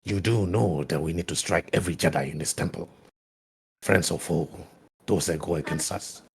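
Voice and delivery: low, hushed villain voice; soft, dark warning to an ally; menacing Sith. Acted. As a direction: press the point quietly, pitched well down. Game master voices Haunt.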